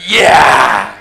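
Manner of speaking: loud low cheering shout; women cheering. Retold or imitated. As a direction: yell it like a cheering crowd. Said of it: "all the women are going".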